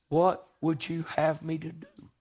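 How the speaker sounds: gentle question. Retold or imitated. Jesus asks gently.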